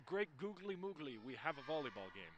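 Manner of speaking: lightly imitated commentator catchphrase, low and soft; Brendan Larson. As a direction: quote a fellow commentator, dropping low and quiet. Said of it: "As another great commentator I know, Brendan Larson, would say".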